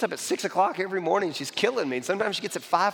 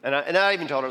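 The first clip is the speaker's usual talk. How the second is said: ordinary delivery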